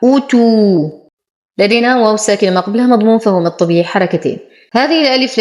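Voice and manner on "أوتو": "أوتو" starting on a short rounded u, never a; ضم الهمزة. Shown as a demonstration. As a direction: open "أوتو" with a brief u and hold the last vowel. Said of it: "والهمزة مضمومة وليست مفتوحة". ("أوتو" بضم الهمزة ضمة قصيرة)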